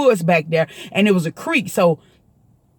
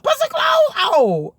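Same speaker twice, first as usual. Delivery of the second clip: much higher yell, dragged slow; slowed mock shout; much slower than usual. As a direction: stretch the yell out slowly.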